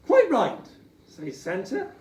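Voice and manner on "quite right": high, loud, bright agreement; delighted agreement. Acted. Santa agreeing with his wife, delighted. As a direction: jump in with glee.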